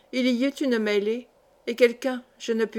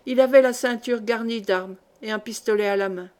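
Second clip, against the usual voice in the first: plain reading voice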